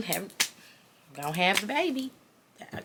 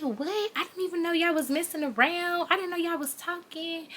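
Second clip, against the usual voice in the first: high, hushed astonishment; mock surprised relative; 9 semitones higher, 7 dB quieter than usual. Relative learning who the father is, soft high-pitched surprise. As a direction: imitate a man softly taken aback. Relative retold taken aback by the news.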